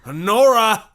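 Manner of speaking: loud stern call; shouting her name. Acted. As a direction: summon his daughter. Father calls daughter.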